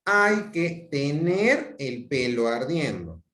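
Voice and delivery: slow, stressed Hay que; deliberate instruction; imperative. Shown as a demonstration. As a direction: drag out the order. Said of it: "la estructura hay que más infinitivo".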